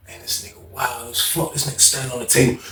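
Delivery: low quiet aside; muttered to self. Retold or imitated. Watching cellmate stand on the table, muttered aside. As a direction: say it under your breath, amazed.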